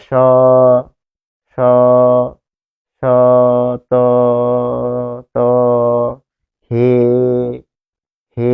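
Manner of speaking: স, ত, হে each held and repeated with long pauses; syllable by syllable. Shown as a demonstration. Teacher sounds out each syllable of the line for the class. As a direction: sound out each syllable separately, pausing between. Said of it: "ঠিক এরকম যখন বলছি".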